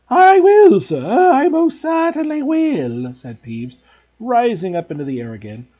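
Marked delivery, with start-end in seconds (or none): 0.1-3.1 high loud grovelling poltergeist; Peeves' voice. A narrator's reading. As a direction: fawn eagerly like a ghost.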